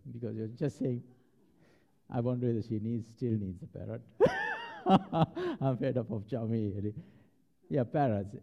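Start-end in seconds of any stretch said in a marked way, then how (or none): 4.1-5.5 sudden high burst of laughter; squealing laugh. Real, not acted. Laughing at the parrot story.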